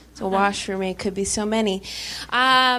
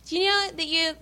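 podcast hosts' voice; 5 semitones higher than usual. Retold or imitated. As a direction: imitate the two hosts, pitched up at first. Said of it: "I don't know why I'm making that, that voice".

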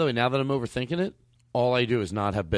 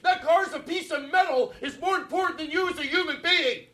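hammed-up overreacting rage. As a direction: overdo the fury at the friend, half laughing. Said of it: "overreactor, calmer downer bit".

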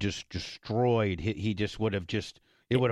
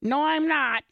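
mock Tuttle yelling no, pitched much higher and louder; high loud refusal; 5 dB louder, slower than usual. Retelling Tuttle refusing to do the fireworks stunt, mock high yell. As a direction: squeal it back as the stubborn guy. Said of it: "Tuttle refusing, retold".

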